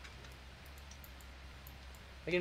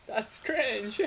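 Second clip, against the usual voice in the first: laughing; 13 semitones higher than usual. Real, not acted. Laughs echoing friend.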